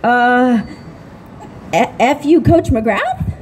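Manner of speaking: lightly imitated nervous stammer; mock scared backing down. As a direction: falter and correct yourself meekly. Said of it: "Wesley Snipes is all scared of her".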